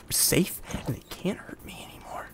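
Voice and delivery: unvoiced hushed relief; whispering. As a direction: whisper it like a survivor. Relief after escape.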